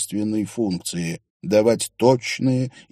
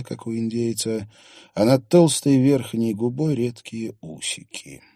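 usual speaking voice

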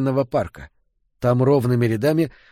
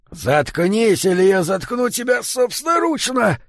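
high, strained threat under a heavy load; puffing threat; 10 semitones higher than usual. A narrator's reading. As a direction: puff it out angrily while straining. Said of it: "пропыхтел Йо". (пыхтя, с натугой)

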